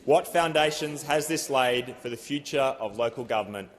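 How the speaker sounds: usual speaking voice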